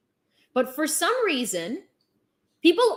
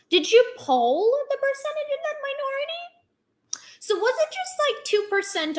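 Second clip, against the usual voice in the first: incredulous; 10 semitones higher than usual. Real, not acted. Incredulous mocking question.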